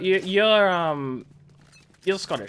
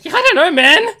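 high, loud outburst; exasperated yell; 14 semitones higher, 11 dB louder than usual. Real, not acted. Player baffled mid-game, exasperated yell.

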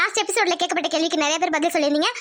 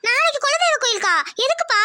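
put-on much higher, startled girl; surprised daughter; 9 semitones higher than usual. Daughter asking father why, surprised. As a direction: ask in surprise.

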